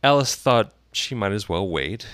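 usual speaking voice

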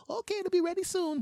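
very high falsetto; squeaky, sing-out phone voice; restaurant order taker. Acted. Worker confirming the order, falsetto.

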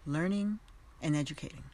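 ordinary talk

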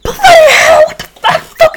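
high-pitched mock scream, British accent; hammed-up, squealed British outburst; imitated British screaming. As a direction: scream it like an outraged British gamer. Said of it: "be screaming".

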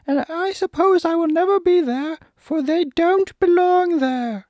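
very high, loud squeaky monkey; Monkey King, emphatic refusal. Acted. Monkey King refuses Dorothy and departs, high loud squawk. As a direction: squawk it out, building to a shrieked farewell.

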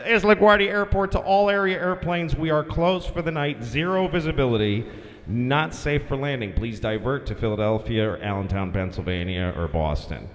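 lightly voiced controller's radio announcement; air traffic controller. LaGuardia guy to all area airplanes, controller voice. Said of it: "the LaGuardia guy comes".

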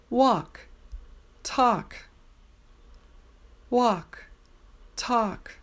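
Walk and talk said with no L sound; silent L. Shown as a demonstration. Teacher modelling walk and talk for practice. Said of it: "Twenty-three".

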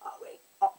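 breathless whisper of amazement; hushed amazed whisper; whispered awe. Retold or imitated. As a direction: gasp it out in a whisper. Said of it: "it always just literally has to be like".

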